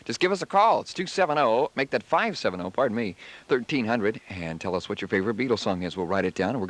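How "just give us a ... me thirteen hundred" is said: ordinary talk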